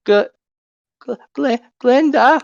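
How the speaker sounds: high, halting fairy stammer; timid fairy voice. Acted. Shy fairy stammers her name.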